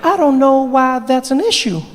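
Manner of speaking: put-on high-pitched young protest; voice lacking bass. Barack questions Mandela's advice, high-pitched. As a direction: object in a thin high register. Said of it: "add some bass to your voice".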